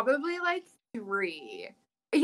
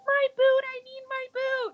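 put-on very high whiny child wail; wailing toddler. Daughter wailing for her lost boot, very high whiny child voice. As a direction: whine like a toddler who wants her boot.

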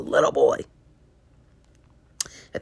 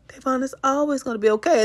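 put-on higher, tender reassurance to a child; consoling toddler; 8 semitones higher, 4 dB louder than usual. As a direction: soothe a crying little boy. Mother consoling crying toddler.

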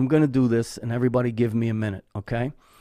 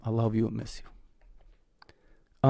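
tender farewell; 5 dB quieter, much slower than usual. Real, not acted. Speaking to late father.

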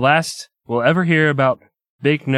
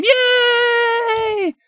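mock cheer. Mock cheering the gang's rescue.

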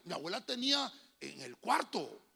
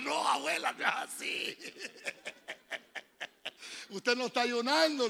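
higher, louder half-laughing objection; laughing loud protest; 6 semitones higher, 7 dB louder than usual. Retold or imitated. Grandson correcting his grandmother, loud and laughing. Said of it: "Y yo le dije".

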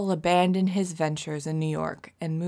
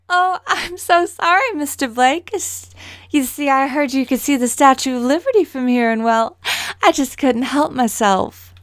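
higher, louder ditzy head voice; bubbly breathless ditz apologizing sweetly; ditzy Harley Quinn; 5 semitones higher, 9 dB louder than usual. Acted. Actor reads the line ditzy for the director, higher and louder. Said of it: "a little bit more Harley Quinn".

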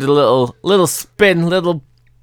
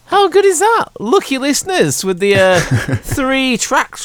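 excited; 11 semitones higher, slower than usual. Real, not acted. Excited praise of the bonus tracks.

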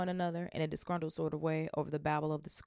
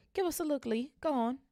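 much higher, pleading; eager kid; 10 semitones higher, 4 dB louder than usual. Acted. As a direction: beg to see the box. Crowd kid begging.

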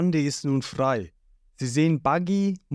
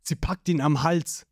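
plain reading voice

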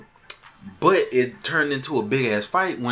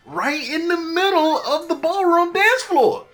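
loud, very high-pitched disbelief; pitched way up, incredulous; scandalized exclamation; 6 dB louder than usual. Real, not acted. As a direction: exclaim it like outrageous gossip. Scandalized storyteller stressing outrageous setting.

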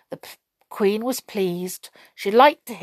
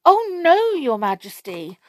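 pixie voice; 8 semitones higher, 4 dB louder than usual. A narrator's reading. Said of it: "answered a pixie".

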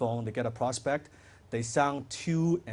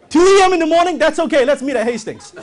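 high, loud, laughing eagerness; hammed-up eager seller, laughing; overeager salesperson, laughing; 12 semitones higher, 13 dB louder than usual. Shown as a demonstration. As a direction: laugh through the desperate yes. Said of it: "so eager, excited".

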